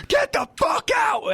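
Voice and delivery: high, drawn-out reenacted yell; snapped, furious shout. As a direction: bellow it slowly, pitch way up. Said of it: "I just snapped".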